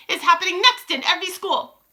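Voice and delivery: high, loud question to the crowd; witch's shout. A narrator's reading. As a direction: demand it of the coven. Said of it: "shouted the Grand High Witch".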